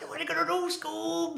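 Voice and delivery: high-pitched cartoon slacker voice; Shaggy from Scooby-Doo. Showing off a party-trick impression to the partner, high cartoon voice. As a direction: squeak it high, stretched into a catchphrase. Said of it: "Shaggy from Scooby-Doo is like".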